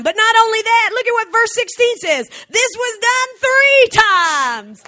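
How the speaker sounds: high-pitched, excited preacher's proclamation; full-force preaching. Real, not acted. Preacher showing the congregation what verse sixteen says, full-force preaching. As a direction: build to a big, stretched declaration.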